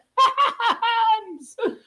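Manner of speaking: high loud laughing fit; loud cackle. Real, not acted. Streamer laughing at the Cowboys, loud cackle. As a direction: burst out cackling.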